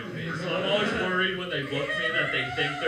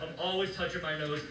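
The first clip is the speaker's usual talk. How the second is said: ordinary talk, nothing marked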